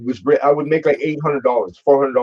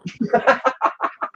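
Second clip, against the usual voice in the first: high breathless laugh; 12 semitones higher than usual. Real, not acted. Laughing at his own paycheck story, breathless laugh. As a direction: laugh at the memory.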